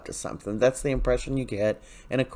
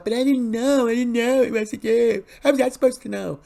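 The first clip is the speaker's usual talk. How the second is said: much higher, whining plea, pitched up and a bit louder; whiny Jada voice; 10 semitones higher, 4 dB louder than usual. Retold or imitated. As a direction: play the desperate girlfriend begging in a high whine. Jada pleading with Rafe.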